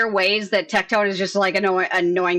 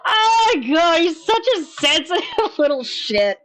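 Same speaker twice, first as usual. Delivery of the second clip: laughing; 8 semitones higher than usual. Real, not acted. Laughing at his complaint.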